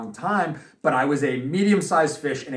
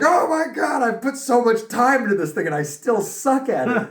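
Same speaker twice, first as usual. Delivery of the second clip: high, laughing mock whine while crying; half-laughing, squeak out the self-pity; 9 semitones higher, 3 dB louder than usual. Boxer retelling crying in his car, mock whining. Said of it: "being like".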